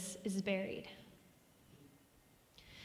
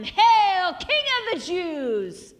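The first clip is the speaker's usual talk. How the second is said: loud, high, drawn-out mock acclaim; mocking hail; 7 semitones higher, 9 dB louder, much slower than usual. Soldiers kneeling before Jesus to mock him, loud jeering hail. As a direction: call it out slowly in mockery. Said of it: "mocked him".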